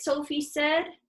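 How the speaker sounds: ordinary delivery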